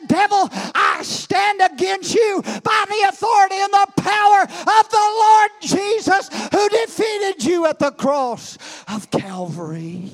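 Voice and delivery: high, loud shouted rebuke, slowing at the end; rebuking the devil. Acted. Believer rebuking the devil, full shout. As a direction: command the devil with authority. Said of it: "and say".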